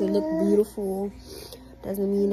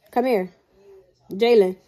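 calling out; 9 dB louder than usual. Real, not acted. Calling son over.

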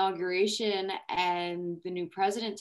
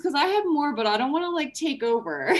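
high, loud, still laughing; speaking through laughter, much higher and louder; laughing through words; 10 semitones higher, 7 dB louder than usual. Real, not acted. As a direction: ask the room while giggling. Laughing, handing over the floor.

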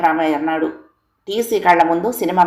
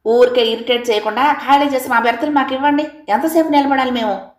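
higher, mildly heated snap; wife snapping at the couple, higher pitched; 6 semitones higher than usual. A narrator's reading. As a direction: bite back, fed up with waiting. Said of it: "Manga కస్సుమంది".

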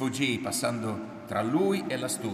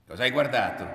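sudden loud, menacing question; loud, threatening demand; dangerous voice; 3 semitones higher, 10 dB louder, faster than usual. A narrator's reading. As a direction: challenge them with real menace in your voice. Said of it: "domandò lui con una voce pericolosa".

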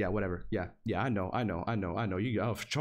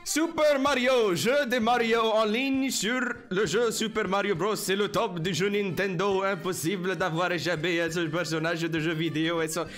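hammed-up French; 3 semitones higher than usual. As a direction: read it out in over-the-top French. Hammed-up reading of French website.

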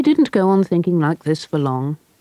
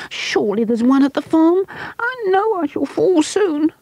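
put-on frightened boy; 12 semitones higher than usual. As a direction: dread falling, slowing at the end. Scared boy voiced.

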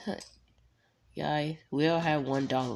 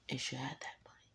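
whisper; 3 semitones lower, 14 dB quieter than usual. Pretend. Greedy aside.

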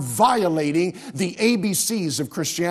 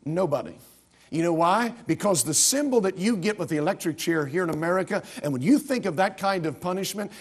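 ordinary delivery

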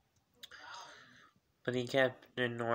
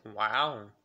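amazed; 7 dB louder than usual. Retold or imitated. Starstruck reaction to crush.

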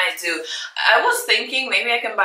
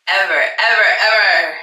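louder, ringing out the repeats; excited; 6 dB louder than usual. Real, not acted. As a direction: shout for joy. Quiet then loud repeat.

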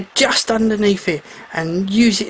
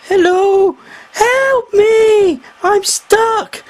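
high, loud desperate call; 14 semitones higher, 3 dB louder, much slower than usual. A narrator's reading. Trapped mermaid calls to the shark for help. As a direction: shout up to the passing shark.

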